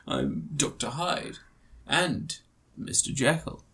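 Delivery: hammed-up Russell Crowe, quiet and slow; Russell Crowe impression. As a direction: murmur it like a sinister doctor. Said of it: "he goes".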